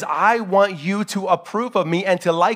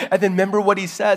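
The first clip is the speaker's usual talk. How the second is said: normal talking voice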